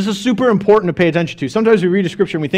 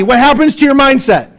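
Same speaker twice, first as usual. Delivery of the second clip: normal talking voice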